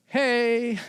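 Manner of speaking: high, drawn-out tentative hello; mock-timid greeting, pitched well up. Preacher re-enacts nervously approaching an intimidating biker, timid greeting. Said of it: "summon up my courage".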